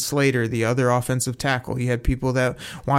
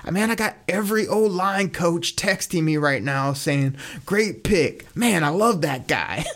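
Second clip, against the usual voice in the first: excited coach voice, higher; imitating Dan Campbell; 4 semitones higher than usual. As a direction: gush about the texts from line coaches. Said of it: "you see him say".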